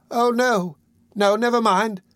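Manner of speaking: high-pitched woman's voice; young woman. A narrator's reading. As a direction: protest lightly in a high voice.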